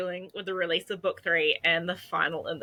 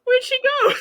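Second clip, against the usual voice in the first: high laughing cry of surprise; a high, laughing where'd she go; 7 dB louder than usual. Real, not acted. Co-host vanished from the call.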